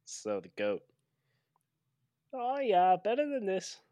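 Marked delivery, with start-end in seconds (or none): 2.3-3.8 put-on Minnesota accent. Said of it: "trying to do a Minnesota accent there".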